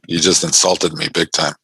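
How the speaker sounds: usual speaking voice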